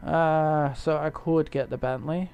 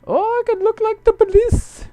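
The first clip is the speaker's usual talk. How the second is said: excited squeal; 7 dB louder than usual. Real, not acted. Gamer excited by police car.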